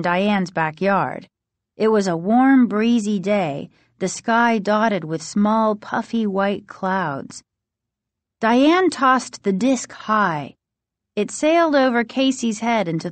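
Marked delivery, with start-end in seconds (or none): none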